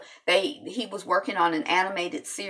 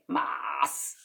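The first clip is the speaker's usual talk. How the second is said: fake Rastafarian accent; 6 semitones lower, 6 dB quieter than usual. Shown as a demonstration. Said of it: "my fake phony Rastafarian accent".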